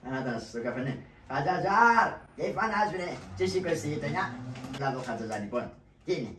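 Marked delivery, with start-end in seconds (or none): 1.3-4.3 higher and louder, calling across the café; man hailing his friend at the coffeehouse. Acted. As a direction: hail a friend from the doorway.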